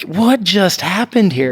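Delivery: high, slow, incredulous question; retold disbelief. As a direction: stretch it out in stunned amazement. Retells stunned reaction to outburst.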